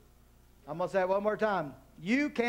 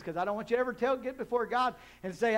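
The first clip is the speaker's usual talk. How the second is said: ordinary talk, nothing marked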